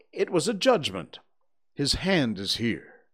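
hint of a solemn preacher's pronouncement; lightly voiced minister. A narrator's reading. Minister to the crowd at the body, lightly preachy. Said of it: "said the minister".

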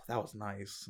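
soft, low sigh of relief; exhale, then say it quietly and low; calm. Acted. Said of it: "it's just so calm".

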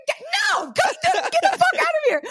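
very high, squealed mock yell; retold laughing shout. Retelling chasing the lady off the porch, laughing yell. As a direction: yell it through laughter, stumbling over the start.

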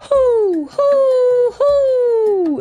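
loud, high, long hoots; owl hoot. A narrator's reading. Mother Owl hoots toward the east, loud and high. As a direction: hoot out to wake the sun. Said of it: "turned her head toward the east and hooted".

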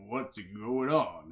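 slow, drawn-out sleepy question; groggy. Acted. Party woken by a horn blast, groggy. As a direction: drag the words out as if just woken. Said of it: "everyone's groggily like".

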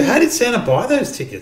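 put-on high, curious little girl voice. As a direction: ask it like a puzzled small child. Father voices young daughter.

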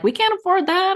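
high, sharp panicked protest; retelling her freakout at dinner over the tip, high and panicked; freaked-out outburst. Retold or imitated. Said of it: "And I freaked out".

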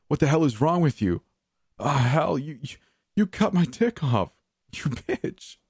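castrated prisoner shrieking at her; screaming in pain. A narrator's reading. Man screaming after mutilation.

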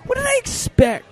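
very high, squealed self-reproach; freaking out. Retold or imitated. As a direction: act out a panicked squeal. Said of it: "I'm freaking out".

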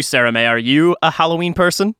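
ordinary talk, nothing marked

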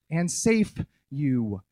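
ordinary talk, nothing marked

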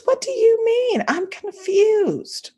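high, slow playing-dumb girl voice; innocent teen daughter. Mom voicing her daughter acting clueless. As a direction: stretch out a fake confused innocence.

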